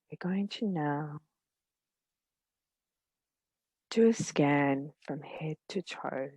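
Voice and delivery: gentle meditation guide, long pauses between phrases; unhurried, soothing guidance, letting each phrase settle; guided meditation. Real, not acted.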